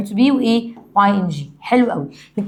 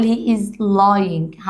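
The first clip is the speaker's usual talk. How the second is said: he is lying spelled out slowly, word by word; slow model sentence; much slower than usual. Shown as a demonstration. Teacher modelling the correct sentence for students, slow. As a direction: dictate he is lying slowly for the class. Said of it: "بالشكل الصحيح".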